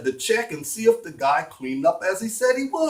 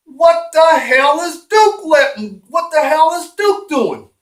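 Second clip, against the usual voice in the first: incredulous outburst, pitched way up; baffled exclamation; 10 semitones higher, 6 dB louder than usual. Acted. Davy's baffled thought, acted out.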